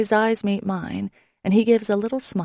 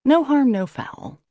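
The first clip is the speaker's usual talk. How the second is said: a hint of a higher, louder, slow and easygoing God; imagined God; 8 semitones higher, 5 dB louder than usual. Narrator imagining God forgiving her, in a lightly voiced easygoing manner. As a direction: forgive her breezily, drawing it out. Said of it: "I imagine him saying".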